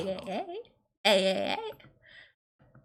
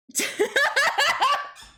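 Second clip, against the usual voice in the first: high laugh; 12 semitones higher, 4 dB louder than usual. Real, not acted. Laughs mid-reading.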